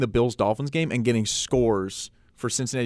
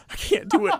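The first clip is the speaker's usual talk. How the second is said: high, laughing through the words; giggling in defeat; helpless laughter; 4 dB quieter than usual. Real, not acted. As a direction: say it while cracking up. Cracks up at his own scenario.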